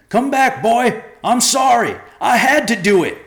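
high, full-volume calling out; desperate shout. A narrator's reading. Joe shouts after the fleeing boy, desperate. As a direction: shout the apology after someone running away. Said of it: "Joe shouted after him".

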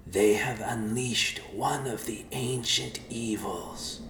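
slow, grave warning voice; mysterious voice. A narrator's reading. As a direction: warn slowly from afar. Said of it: "a voice said".

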